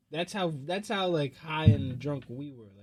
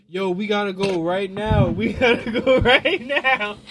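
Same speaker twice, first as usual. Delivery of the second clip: urgent call; 4 semitones higher, 10 dB louder than usual. Retold or imitated. Boys bursting in to drag him out, loud urgent yell. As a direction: bellow the alarm, laughing at the end.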